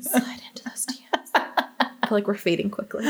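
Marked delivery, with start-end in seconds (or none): 0.0-1.3 soft unvoiced whisper. Real, not acted. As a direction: whisper it teasingly. Echoing whispered DM joke.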